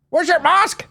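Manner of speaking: very high, loud squawk; mock character yell. Comic voicing a fighting game character, high mock yell. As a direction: screech it like a game catchphrase.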